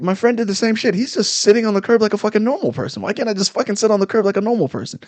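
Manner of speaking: high-pitched indignant rant, retold; worked-up replay of an outraged complaint; exasperated protest. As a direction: argue the unfairness, speeding up at the end. Retold protest to a yelling cop.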